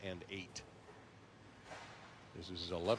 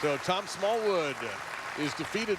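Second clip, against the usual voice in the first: announcer; 6 semitones higher, 5 dB louder than usual. Acted. Announcer hypes next match.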